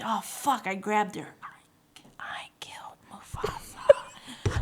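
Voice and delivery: hushed guilty whisper, slow at the end; mock whisper. Voicing her wife realizing she pulled her down, a slow guilty whisper.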